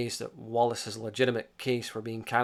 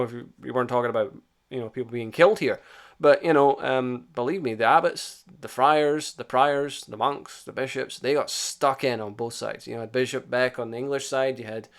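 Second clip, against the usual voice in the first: ordinary delivery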